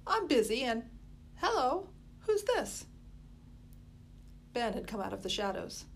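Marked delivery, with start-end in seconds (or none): 0.1-2.9 higher, rising surprised hello; Molly surprised. A narrator's reading. Molly noticing the stranger boy, high surprised. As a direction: break off busy and spot the stranger.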